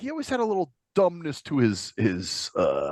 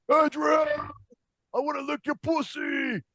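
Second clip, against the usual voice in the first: higher-pitched, softer mock movie holler; Rocky; 8 semitones higher, 5 dB quieter, slower than usual. Imitating the boxer calling to Adrian, mock Rocky holler. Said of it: "All I hear is him going".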